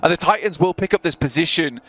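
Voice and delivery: high, urgent esports caster; excited play-by-play. Real, not acted. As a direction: call each kill as it happens. Live play-by-play of a fight.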